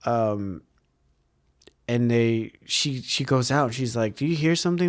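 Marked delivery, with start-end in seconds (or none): 4.2-4.9 lightly voiced little girl, higher; a hint of a curious little girl. Little girl asks Jim about a sound, lightly higher child voice. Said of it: "the little girl says".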